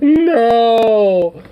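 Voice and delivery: high, loud agonized no; drawn-out wail of no. Real, not acted. Reacting in horror to the CNN show, agonized wail. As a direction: wail it out in dismay. Watching clip, wails in dismay.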